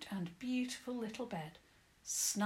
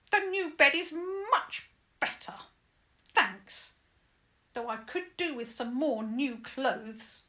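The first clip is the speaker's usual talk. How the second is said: high, loud cheeky pixie; squeaky mischievous pixie voice; trickster tale voices; 7 semitones higher, 8 dB louder than usual. Acted.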